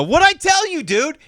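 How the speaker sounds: high, loud vindicated cry; loud, high I-told-you-so; told-you-so exclamation. Retold or imitated. Crowing that he was right.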